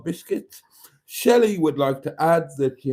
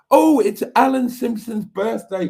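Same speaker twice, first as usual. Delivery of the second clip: sudden realisation; 11 semitones higher, 6 dB louder than usual. Real, not acted. Sudden remembering mid-chat.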